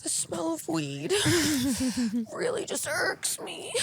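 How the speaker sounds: high, soft whiny voice; mocking complainer. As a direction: play a fussy neighbor.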